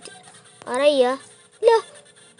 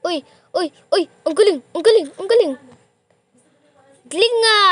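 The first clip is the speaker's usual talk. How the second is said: loud, rising cheers of "Ang galing!"; excited cheering; 5 semitones higher, 10 dB louder, faster than usual. Real, not acted. A kid watching a friend's parkour, loud excited cheering. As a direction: cheer louder each time.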